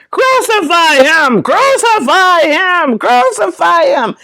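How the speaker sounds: high-pitched imitation of a screaming mob; mock screaming. Play crowd demands a crucifixion, mock screaming. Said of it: "were screaming".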